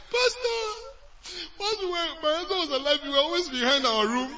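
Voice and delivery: high, loud, half laughing, half crying; tearful excited widow. Acted. Widow telling the pastor their secret, crying and laughing. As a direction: blurt it out through tears and laughter. Widow recognizes the code, emotional.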